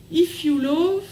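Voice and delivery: reading English with a French accent; "If you love, tomorrow will be fun" in French-accented English. Real, not acted. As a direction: reads the notebook's English motto in a French accent. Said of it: "Alors désolé pour mon accent".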